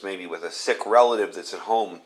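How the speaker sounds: ordinary delivery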